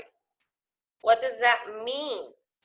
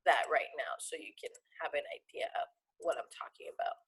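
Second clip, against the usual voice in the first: whispering; 7 semitones lower, 11 dB quieter, faster than usual. Real, not acted. Teacher aside while writing.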